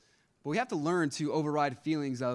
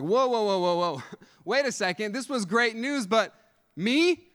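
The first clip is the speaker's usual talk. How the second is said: higher, protesting voice backing away from the call; panicked Moses; 6 semitones higher, 4 dB louder than usual. Retold or imitated. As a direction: plead with God like a scared outcast. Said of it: "And Moses is like".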